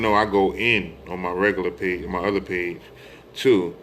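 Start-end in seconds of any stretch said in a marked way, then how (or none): none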